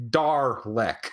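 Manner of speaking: "Darlek" with a full, unsubtle R; "Darlek" with a strong American R; hard American R. Shown as a demonstration. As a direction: say "Darlek" in two parts, DAR-lek, R sounded hard. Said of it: "we don't really have a subtle R noise".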